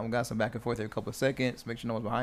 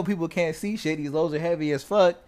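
ordinary delivery

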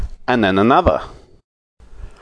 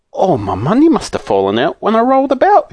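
higher, dismayed little man; saucepan man dismayed; 9 semitones higher than usual. A narrator's reading. Saucepan man finding his purse empty, dismayed. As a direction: squeak out the loss.

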